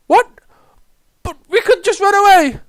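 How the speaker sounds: high, loud, pleading objection; panicked protest. Acted. Soldier objecting to the officer's order, panicked.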